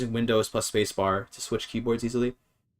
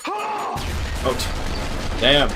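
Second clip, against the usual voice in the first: a sudden, much higher yelp of real fright, then a breathless curse; startled yelp; 4 semitones higher, 4 dB louder, much slower than usual. Real, not acted. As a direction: jump out of your skin at a loud alert. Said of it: "Oh my god, that scared me".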